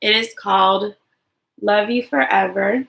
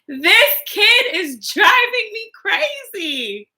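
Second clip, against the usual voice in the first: high, slow, fed-up sigh of a tired mother; exasperated mom; 12 semitones higher, 3 dB louder, slower than usual. Acted. Storybook mother fed up with her toddler, exasperated and slow. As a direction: play the worn-out mother at her limit. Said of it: "his mother would say".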